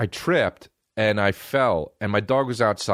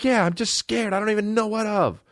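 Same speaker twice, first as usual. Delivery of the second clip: put-on much higher, small child voice; scared kid. Comedian as a child confessing to dad, high and scared. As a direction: whimper it like a helpless little kid. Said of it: "I was like".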